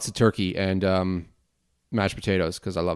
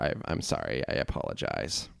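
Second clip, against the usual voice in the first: sheepish apology; 10 semitones lower, 12 dB quieter than usual. Real, not acted. Sheepish apology, much lower and softer.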